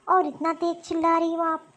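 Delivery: usual speaking voice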